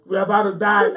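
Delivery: loud, high comic wail; mock panic. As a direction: cry out in hammed-up terror. Manoah panics, acted.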